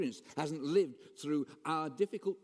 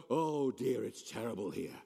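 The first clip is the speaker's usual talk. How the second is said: mock complaint; 2 semitones lower than usual. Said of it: "He doesn't look at them and go".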